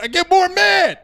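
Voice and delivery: loud, high burst of anger; angry shout. Real, not acted. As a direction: let the anger spike on this line. Explaining growing anger.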